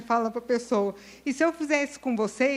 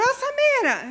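calling out; 11 semitones higher, 3 dB louder than usual. Retold or imitated. Someone calls her in hallway.

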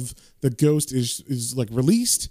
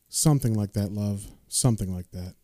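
subdued reply; 6 semitones lower, 5 dB quieter than usual. Retold or imitated. John's subdued reply to child.

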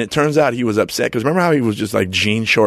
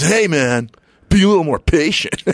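higher put-on fighter voice; imitating Chemo; 7 semitones higher than usual. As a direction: mimic the fighter scolding a driver. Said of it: "That's how he talked".

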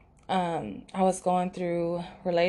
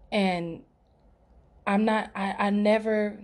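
ordinary talk, nothing marked

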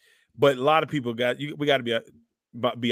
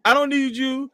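mock offended; 12 semitones higher, 6 dB louder than usual. Real, not acted. Playful mock complaint to cohost.

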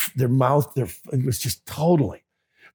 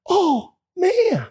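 stunned oh man; 14 semitones higher than usual. Retold or imitated. Reaction to children's story.

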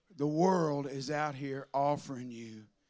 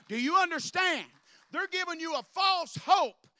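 raised, loud preaching voice; preaching at full force; 11 semitones higher, 3 dB louder than usual. Acted. Pastor rails to his church, loud preaching. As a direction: hammer it home to the congregation.

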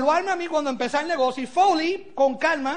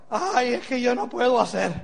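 lower, put-on helpless moan; mock defeatist whine; 4 semitones lower than usual. Speaker mimics someone making excuses, mock defeatist whine.

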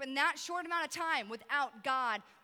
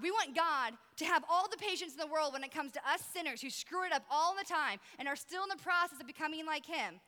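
ordinary delivery

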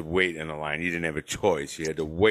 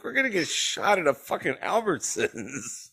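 panicky joking fear; 9 semitones higher than usual. Retold or imitated. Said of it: "I'm like".

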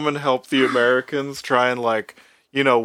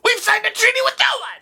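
very high, fast gremlin screech; rapid cartoon creature shriek; John Adams; faster than usual. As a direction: squawk it out like an angry little goblin. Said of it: "John Adams' voice is so good".

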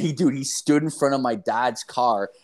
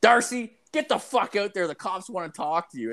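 mom yelling; 8 semitones higher than usual. Retold or imitated. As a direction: call him out like a fed-up mom. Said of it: "is just like".

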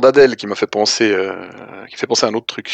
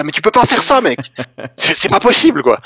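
outraged purist; 6 semitones higher than usual. Retold or imitated. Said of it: "ils deviennent complètement maboules".

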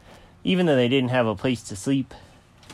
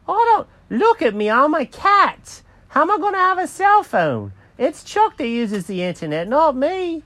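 high, loud cartoon cat protesting; Jenkins the cat, indignant; 4 dB louder than usual. Cat asked about cell phone notifications, high indignant protest. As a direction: protest in a shrieky high character voice.

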